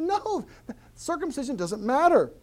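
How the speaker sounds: high, emphatic Paul rebuttal; voicing Paul. Pastor voicing Paul's rebuttal, emphatic and high. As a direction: cut them off as Paul. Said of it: "But Paul said".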